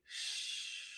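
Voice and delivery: soft unvoiced shh; whispered shush. Shown as a demonstration. Coach showing how she shushes her mind, whispered. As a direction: hush it gently. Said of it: "I started to say".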